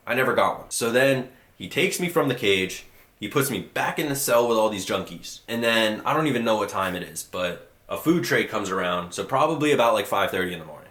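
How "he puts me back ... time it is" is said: usual speaking voice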